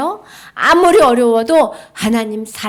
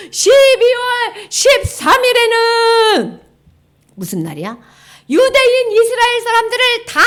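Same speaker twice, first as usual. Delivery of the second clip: high, loud gloating villain with cackles; villain's decree; 11 semitones higher, 4 dB louder than usual. Acted. Haman shouting his evil decree to the scribes, gleeful. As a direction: bellow the order with wicked glee.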